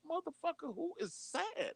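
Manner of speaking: mock outrage at a sulking president; incredulous retort. Incredulous reply to Trump's video.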